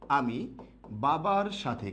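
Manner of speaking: slow, spelled out for writing; slow dictation. Shown as a demonstration. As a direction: dictate the sentence slowly. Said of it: "আমি বাক্য লিখলাম". (ধীরে ধীরে শ্রুতলিখন)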